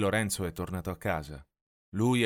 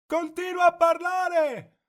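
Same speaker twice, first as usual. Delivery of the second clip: high, loud, slow shouted call; shouting; 8 dB louder, slower than usual. A narrator's reading. Lorenzo shouting to lost Mimì, loud call. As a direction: call out so she keeps talking. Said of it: "grida Lorenzo".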